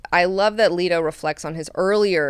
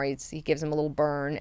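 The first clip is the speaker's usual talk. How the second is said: ordinary talk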